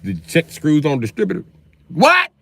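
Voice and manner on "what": much higher, sharp what?; startled what, pitched way up. Acted. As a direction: yelp it high like you missed it. Can't hear over phone noise.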